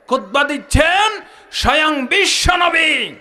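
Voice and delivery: high, drawn-out preacher's shout; rousing proclamation. Real, not acted. Preacher announcing the Prophet himself giving khutbah, rousing shout. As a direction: proclaim it with swelling, stretched words.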